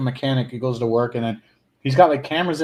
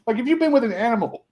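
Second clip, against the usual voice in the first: talking through hiccups; 8 semitones higher than usual. Real, not acted. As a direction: hiccup mid-sentence. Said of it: "sorry, I got the hiccups".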